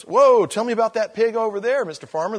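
loud, high, wide-eyed whoa; a city guy gawking at a three-legged pig; amazed visitor. Retold or imitated. Visitor on the farm asks the farmer, loud and amazed. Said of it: "And he goes".

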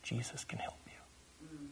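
a slow, breathy whisper. Retold or imitated. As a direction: whisper it slowly into his ear. Repeating it to the possessed man.

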